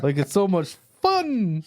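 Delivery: slow, high mock-sweet teen sneer; sneering fake sweet. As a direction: drawl it like a snide teenage girl. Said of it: "you dipped into it".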